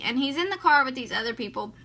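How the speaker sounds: ordinary talk, nothing marked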